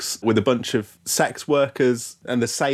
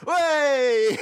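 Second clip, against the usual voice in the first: mock shrill cry. Mimicking a woman in background.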